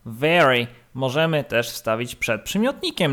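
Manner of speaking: ordinary talk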